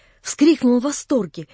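ordinary delivery

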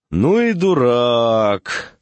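drawn-out, singing tenor taunt; singsong tenor. A narrator's reading. Doctor scolding a hopping patient in passing, singsong tenor. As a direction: stretch it out slowly in a lilting tenor. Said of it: "сказал певучим тенором".

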